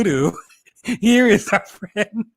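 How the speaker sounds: slow, laughing showman announcement; welcoming the guest onto the stream, slow and grand; drawn-out host intro. Real, not acted. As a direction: stretch it out like a game-show host, giggling.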